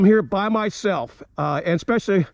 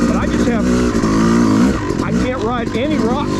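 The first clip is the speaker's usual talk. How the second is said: over engine noise; 4 dB louder than usual. Real, not acted. Talking while riding the dirt bike.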